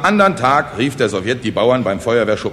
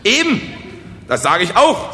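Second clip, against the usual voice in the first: high, loud, eager agreement; delighted; 10 semitones higher, 4 dB louder than usual. Acted. Speaker welcomes the peasants' interest, delighted. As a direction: seize on their interest with delight. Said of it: "erfreut über die Anteilnahme".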